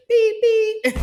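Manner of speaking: high, loud mock phone beeps. Hanging up on coworkers with a high, loud mock beep. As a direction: make a cheeky phone-hang-up noise.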